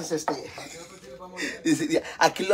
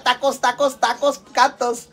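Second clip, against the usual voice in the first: mock vendor call; 6 semitones higher, 5 dB louder, slower than usual. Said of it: "a ver, grita".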